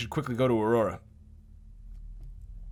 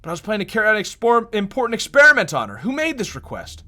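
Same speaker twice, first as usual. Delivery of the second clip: higher accusing voice; character voice; 10 semitones higher, 9 dB louder than usual. Acted. Giselle confronting Doctor Harrington, higher voice. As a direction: lift the pitch and accuse.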